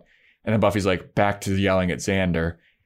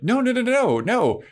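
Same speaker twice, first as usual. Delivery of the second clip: panicked denial. Acted. Xander panicking at angry Buffy.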